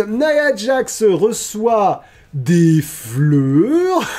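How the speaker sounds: drawn-out, high-pitched proclamation; mock-grand announcement. Host announces that Nia Jax receives flowers, mock-grand. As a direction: stretch it out high like a big reveal.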